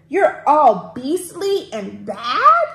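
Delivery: loud, drawn-out accusing question; mild storybook yell. A narrator's reading. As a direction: yell it slowly, as if reading to children. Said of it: "yelled James".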